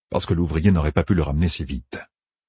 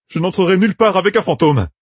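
higher, louder firm refusal; 13 semitones higher, 5 dB louder than usual. A narrator's reading. Matt refuses to enter with a ghost, firm and loud. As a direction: plant your feet and refuse. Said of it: "affirma Matt".

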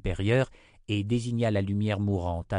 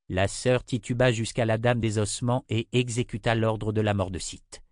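ordinary delivery

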